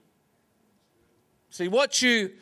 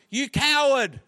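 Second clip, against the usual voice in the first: higher, mock-frustrated call; acted shout, raised pitch; 6 semitones higher than usual. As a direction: holler it like a fed-up boy. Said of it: "Frustrated, he shouted".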